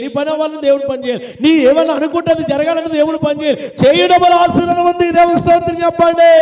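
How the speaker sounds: rapid refrain building to a shout; full-force preaching. Real, not acted. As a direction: work the congregation up to a shouted call for praise. Builds to a call for praise. (ఉద్వేగంగా కేక వరకు పెరిగే ప్రసంగం)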